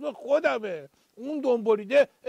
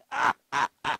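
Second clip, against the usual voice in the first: evil chuckle; 9 semitones lower than usual. Acted. Host laughs at own joke.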